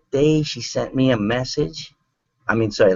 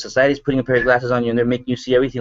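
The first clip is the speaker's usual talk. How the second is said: ordinary talk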